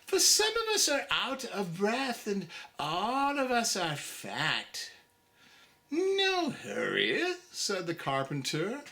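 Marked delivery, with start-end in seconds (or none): 0.0-4.9 squeaky oysters. Acted. Oysters plead. 5.9-7.4 drawling carpenter. Acted. Carpenter replies.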